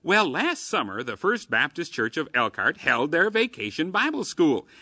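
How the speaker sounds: ordinary talk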